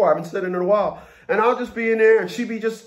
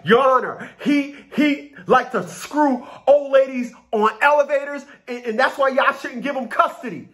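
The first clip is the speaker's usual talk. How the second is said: higher, whiny mock accusation; lightly voiced mocking woman; 8 semitones higher than usual. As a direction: mimic her pleading to the judge. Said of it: "They'll be like".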